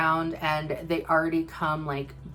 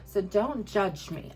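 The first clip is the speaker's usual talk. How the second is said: normal talking voice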